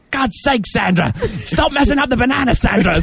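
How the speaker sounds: high, exasperated Australian scolding; Aussie accent. Retold or imitated. Farm worker nagging Sandra over bananas, exasperated Aussie accent. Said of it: "that's how I learned the Aussie accent".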